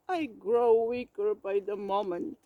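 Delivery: low, pitiful, fading complaint; feeble sick bear. A narrator's reading. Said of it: "coughing pitifully".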